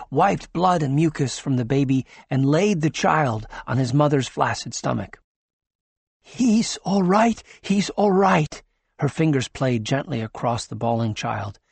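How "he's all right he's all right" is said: relieved. Acted. Doctor relieved at birth.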